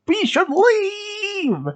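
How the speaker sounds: high, long-stretched cry to flee; screamed warning. Acted. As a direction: call out in alarm, dragging the last word.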